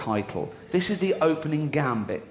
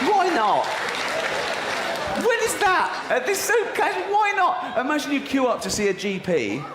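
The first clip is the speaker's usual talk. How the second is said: incredulous shout; 12 semitones higher than usual. Real, not acted. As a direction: shout it in outrage at the flyer. Outraged rant at flyer.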